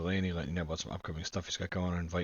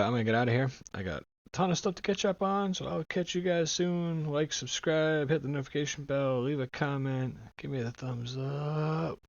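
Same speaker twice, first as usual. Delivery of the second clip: upbeat sign-off; 6 semitones higher, 4 dB louder than usual. Real, not acted.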